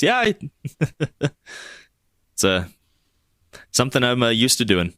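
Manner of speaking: small sheepish laugh, starting high then settling; sheepish chuckle. Acted. Amy admits a shady past to visitors, with a sheepish chuckle. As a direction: chuckle awkwardly through a halting admission. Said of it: "she chuckles a little, uh, sheepishly".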